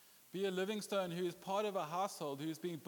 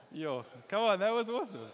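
louder, playful protest; excited; 3 semitones higher, 7 dB louder than usual. Real, not acted. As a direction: hype up your own joke. Defends pun, laughing.

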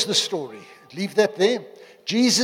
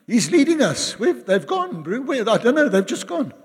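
high, louder acted confusion; mock bewilderment; 5 semitones higher, 4 dB louder than usual. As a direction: play baffled at being left behind, pitched up. Acts out those left behind, bewildered.

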